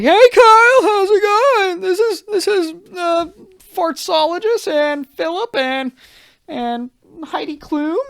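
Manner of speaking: very high, loud mock party squeal; mock party greeters. Party guests getting in Kyle's face, high-pitched mock greeting. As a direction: imitate overexcited guests crowding in with introductions.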